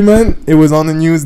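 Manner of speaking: ordinary talk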